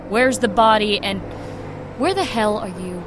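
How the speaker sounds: loud and urgent into the phone; loud call. Acted. Woman demanding her partner's location, loud and urgent. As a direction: yell to find him.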